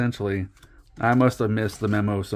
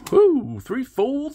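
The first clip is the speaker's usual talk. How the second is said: excited woo; slower than usual. Real, not acted. Excited at a big pull.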